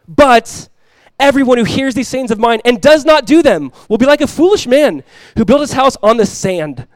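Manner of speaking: loud, high pulpit proclamation; emphatic, projected verse reading; forceful scripture reading. Real, not acted. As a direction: declare the warning to the congregation. Reads the contrasting foolish builder.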